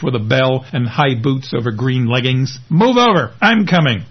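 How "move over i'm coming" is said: higher, louder call across the dock; older woman barging in with a loud call; bossy call. Acted. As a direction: push in loudly, not taking no for an answer. Mary stomps out, calling to them.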